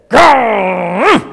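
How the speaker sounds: loud mock growl from the king; hammed-up royal snarl; angry growl. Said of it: "He's so angry, he says".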